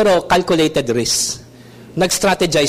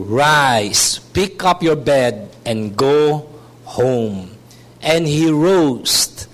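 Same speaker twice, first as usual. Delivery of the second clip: solemn reading; 5 semitones lower, much slower than usual. A narrator's reading. Climax of scripture reading.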